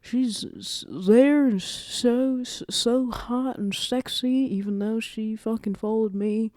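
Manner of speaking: high, drawn-out mock swooning; making a big deal. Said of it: "obviously making a big deal out of it".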